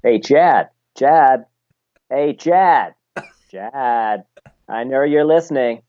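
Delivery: hammed-up American calling out to a buddy; mock American accent. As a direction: call him in an exaggerated American accent. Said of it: "best American accent saying".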